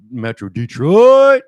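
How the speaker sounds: loud drawn-out hype shout; big, slow, proud hometown holler. Real, not acted. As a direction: belt out the city's name, stretched long. Hyping up their home city.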